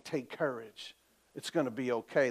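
low, hushed comfort; calm reassurance. Acted. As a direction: soothe them softly, voice dropped low. Preacher voices Jesus reassuring the listener.

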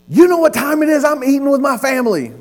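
high, loud mock outburst; irate call recipient. Annoyed customer to telemarketer during family dinner, mock angry outburst. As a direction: imitate an indignant customer interrupted at dinner.

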